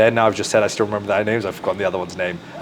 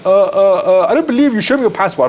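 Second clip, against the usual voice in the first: high, louder mock museum guide, sceptical; mock doubtful guide; 9 semitones higher, 5 dB louder than usual. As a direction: voice the guide up high, hammed-up disbelief. Museum guide doubting, voiced by teller.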